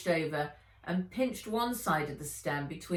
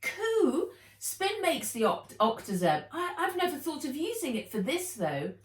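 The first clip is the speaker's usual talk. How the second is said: delighted; 10 semitones higher than usual. Acted. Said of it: "happy to see something familiar".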